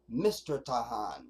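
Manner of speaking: "Mister" leaned on slowly, correcting the name; slow corrective "Mister Tahan"; stress on Mister. Shown as a demonstration. Streamer correcting reporters' name use, slow stressed Mister. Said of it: "but no, no".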